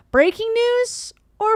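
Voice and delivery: high, slow mock announcer. Streamer introducing a segment like an announcer. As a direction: announce it like a game show.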